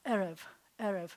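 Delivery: "erev" stressed on the opening "e"; teacher's demo of "erev", accent up front; first-syllable stress. Shown as a demonstration. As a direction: hit the first e of "erev", let "rev" fall. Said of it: "always have the accent on".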